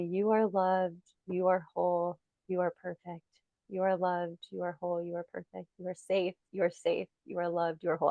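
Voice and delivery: soft, hushed self-soothing mantra. Retold or imitated. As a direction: repeat it softly, like reassuring an inner child. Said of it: "drop into this mantra".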